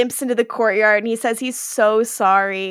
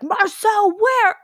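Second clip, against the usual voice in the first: mock all caps yell; 9 semitones higher, much slower than usual. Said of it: "Like, all caps".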